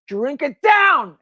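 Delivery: slow, firm order; commanding robber. Acted. Robber ordering the receptionist, slow and firm. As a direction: order her like a gunman.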